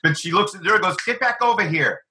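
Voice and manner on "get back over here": higher-pitched firm mother; imitating a mom being firm. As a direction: order a child back without shouting. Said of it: "So she's firm to the girl".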